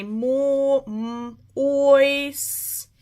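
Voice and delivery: "mo-", a hummed "m-", then long held "ois"; sounded out. Shown as a demonstration. Teacher breaking moisture into its first syllable, sounded out. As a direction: isolate each sound, stretching "ois" out. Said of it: "So here's my".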